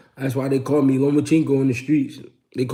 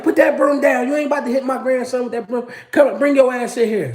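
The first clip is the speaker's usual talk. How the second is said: put-on much higher, louder old lady voice; scolding grandma; 12 semitones higher, 6 dB louder than usual. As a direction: play a stern grandma stopping a fight.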